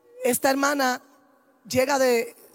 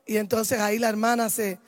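usual speaking voice

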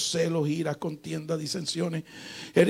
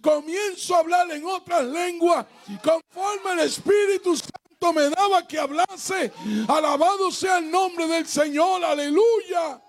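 preaching at full force; 5 semitones higher than usual. Acted. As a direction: preach it out with rising fire. Climax of testimony, shouted preaching.

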